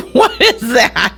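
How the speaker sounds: real laugh breaking into the question. Real, not acted. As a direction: ask it while laughing. Laughing at odd sports name.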